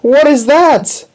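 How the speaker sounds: very high, loud, drawn-out shriek; horrified scream. A narrator's reading. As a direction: scream it slowly in alarm. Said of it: "She screamed".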